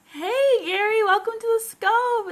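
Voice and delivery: high, loud burst of welcome; hey, Gary, called out high and loud; excited greeting. Real, not acted. Host greets arriving viewer.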